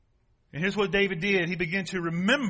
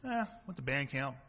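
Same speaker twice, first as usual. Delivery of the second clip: low, quiet, offhand mumble; unimpressive classmate; 6 semitones lower, 5 dB quieter than usual. Acted. Classmate answers about band camp in homeroom, underwhelmed and offhand. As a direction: shrug it out as small news. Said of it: "Sitting there in homeroom class".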